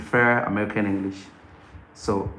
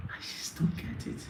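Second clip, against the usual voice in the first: breathy whispered exasperation, no voice at all; whispered aside; 14 dB quieter than usual. Acted. Host whispering his frustration to viewers, exasperated whisper. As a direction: whisper it to yourself in disbelief.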